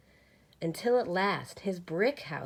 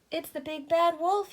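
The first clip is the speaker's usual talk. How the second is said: much higher, louder cry of fright; alarmed cry; 10 semitones higher, 6 dB louder than usual. A narrator's reading. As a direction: cry out the danger. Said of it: "he cried".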